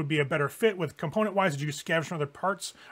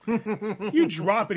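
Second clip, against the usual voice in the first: laughing through the words; amused, breaking into laughter; 5 semitones higher, 3 dB louder, much slower than usual. Real, not acted. GM laughs at player's move.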